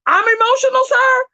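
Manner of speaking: high, disbelieving rising question; incredulous sarcasm. Real, not acted. Woman on the panel mocking his claim that she's emotional, incredulous. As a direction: throw the accusation back in mock disbelief.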